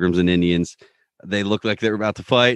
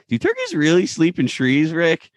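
Morty; 8 semitones higher than usual. Acted. Acts Morty questioning Rick.

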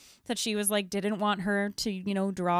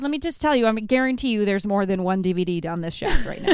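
fast, louder disbelieving retort; incredulous scoff; 4 semitones higher, 8 dB louder, faster than usual. Retold or imitated. Mom retelling her retort to the librarian, fast loud incredulous. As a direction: scoff at the whole idea.

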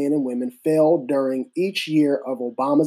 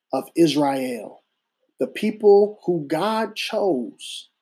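preaching. Acted. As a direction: deliver it like a sermon. Slow sermon with long pauses.

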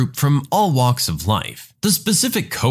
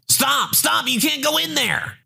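high, urgent yell to stop him; lifeguard shouting; 13 semitones higher than usual. Retold or imitated. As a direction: shout a warning across the pool, much higher.

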